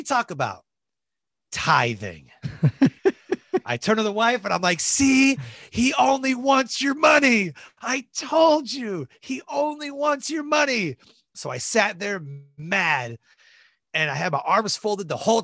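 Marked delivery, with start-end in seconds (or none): 4.8-5.4 mock triumphant shout. Mocking wife about the preacher. 5.7-7.5 high, slow, drawn-out gloat; slow high-pitched I-told-you-so to the wife; mock told-you-so. Mocking wife about the preacher. 7.8-11.0 high, slow shouted gloat; slow high-pitched shout at the wife; mock told-you-so shout. Mocking wife about the preacher.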